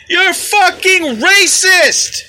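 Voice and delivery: high, loud, drawn-out mock scream; imitated screaming. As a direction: scream it slowly, imitating an irate protester. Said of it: "She's screaming at the top of her lungs".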